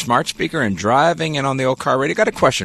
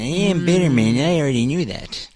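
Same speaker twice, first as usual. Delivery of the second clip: put-on higher know-it-all voice; mock a smug texter; mocking impression, pitched up; Bitterman; 4 semitones higher than usual. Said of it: "Bitterman, however, already knew this fact".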